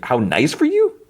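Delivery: puzzled, high rising question; baffled echo. Real, not acted. Host questioning Luthen's odd remark, baffled. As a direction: repeat it in confusion, pitch climbing at the end. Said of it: "I don't know what, what he's saying".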